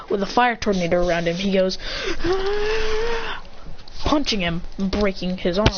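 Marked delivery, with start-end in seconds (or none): 1.8-4.0 long, high, held-back battle cry; mock attack scream. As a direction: draw out a high yell as if punching. Attack cry while punching.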